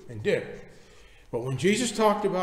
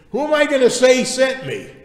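high, loud, exasperated question; acted-out objection, raised in pitch and volume; protesting; 9 semitones higher, 9 dB louder than usual. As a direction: push back like a reluctant messenger. Acts out Moses arguing with God.

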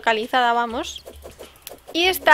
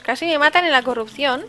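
usual speaking voice

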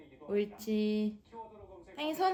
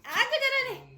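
high excited praise; 13 semitones higher, 5 dB louder than usual. Real, not acted. Praising the dog.

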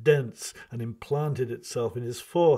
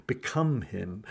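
usual speaking voice

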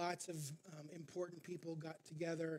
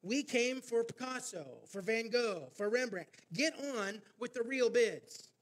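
louder, higher heckle from the back; impatient bidder shouting, building louder; angry shout; 5 semitones higher, 7 dB louder than usual. Retold or imitated. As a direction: yell at the auctioneer to move on. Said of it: "Another angry voice shouted from the back".

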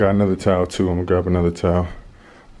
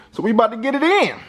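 hyped up; 7 semitones higher, faster than usual. Real, not acted. Hyping up hair session.

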